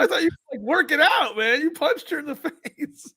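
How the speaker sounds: high, squealing disbelief; incredulous scolding. Pretend. Scolding the kid in fun.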